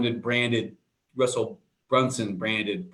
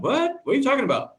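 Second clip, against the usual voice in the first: retold shock, pitched much higher; shocked what; 12 semitones higher, faster than usual. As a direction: replay the jolt of hearing the news. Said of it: "I'm like".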